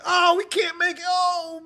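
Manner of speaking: high, loud panicked pilot cry; panicked shout. Acted. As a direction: yell like you're about to crash.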